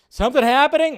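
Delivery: sudden high, loud surprise; surprised. Real, not acted. Streamer startled by something happening on screen, surprised. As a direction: jump up in pitch as if caught off guard.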